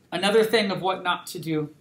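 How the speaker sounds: ordinary talk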